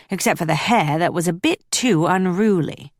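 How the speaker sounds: usual speaking voice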